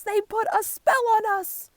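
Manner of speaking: high, loud accusing shout; outraged cry. A narrator's reading. Animals blaming the warthogs, loud high cry. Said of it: "cry the other animals".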